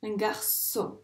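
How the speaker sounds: "garçon" divided into gar-çon; syllable by syllable. Shown as a demonstration. Teacher repeating for a pupil, "garçon" split in syllables. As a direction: separate the two syllables of "garçon". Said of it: "les ronds pour chaque syllabe".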